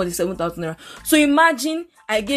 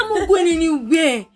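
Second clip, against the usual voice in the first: raised, higher refusal to a bike man; louder, exasperated retold refusal; retold shout; 6 semitones higher, 4 dB louder than usual. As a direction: re-enact snapping back, pitched up. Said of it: "And I shouted at".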